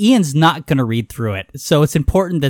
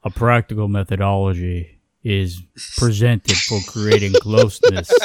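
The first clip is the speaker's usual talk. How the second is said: Darth Vader voice; 7 semitones lower than usual. Pretend. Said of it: "start trying to sound like, uh, Darth Vader".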